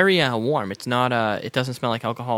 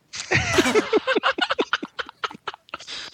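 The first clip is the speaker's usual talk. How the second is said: high squealing laugh. Real, not acted. Still laughing after the swig.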